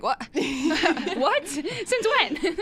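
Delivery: laughing disbelief. Retold or imitated. Grandpa reveals a new cousin.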